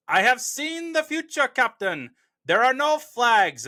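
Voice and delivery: put-on high, loud German-accented villain; terrible German accent. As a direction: declaim it in a hammy German accent. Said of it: "that was my terrible German accent".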